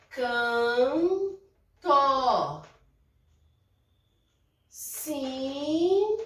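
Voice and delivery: Canto and Sinto with drawn nasal can and sin; nasal demo words. Shown as a demonstration. Teacher listing N words for pupils, nasal syllables. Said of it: "antes do T é N".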